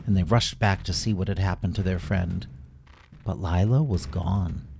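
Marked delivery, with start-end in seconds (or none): none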